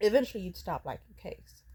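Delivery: ordinary talk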